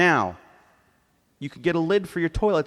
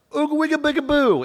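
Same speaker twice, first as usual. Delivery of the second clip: put-on high, loud drunk babble; drunk gibberish; 13 semitones higher, 4 dB louder than usual. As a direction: slur like a wild drunk on the street. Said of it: "Some drunk jumps out and goes".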